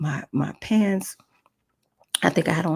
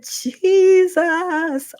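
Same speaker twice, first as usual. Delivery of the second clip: long, very high loud wail of the name; calling on Jesus; 13 semitones higher, 6 dB louder than usual. Retold or imitated. Woman calls Jesus over and over, drawn-out loud cry. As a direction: call out desperately to heaven. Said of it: "I called on the name of Jesus".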